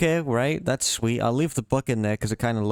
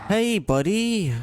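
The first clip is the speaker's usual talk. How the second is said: taunting call; 9 semitones higher, slower than usual. Acted. Taunting the monster.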